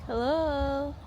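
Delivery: a light, sweet little cutesy hello; a playful hello in a mildly cute voice. Pretend. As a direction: put on a touch of cute. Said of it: "Ay, nagpa-cute!".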